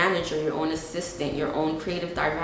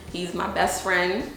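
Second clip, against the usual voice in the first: ordinary delivery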